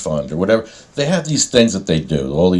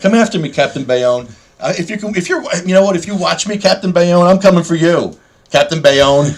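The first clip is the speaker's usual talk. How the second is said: mock challenge; 10 semitones higher, 4 dB louder than usual. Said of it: "He's gonna be like".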